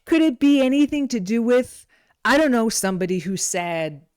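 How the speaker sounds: normal talking voice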